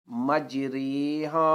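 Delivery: مجریہا very slow, with "ray" and a long "haa"; Quranic pronunciation. Shown as a demonstration. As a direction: recite مجریہا with each long vowel drawn out. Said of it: "کا تلفظ مجرے". (مجریہا ٹھہر کر، را کو قطرے کی طرح)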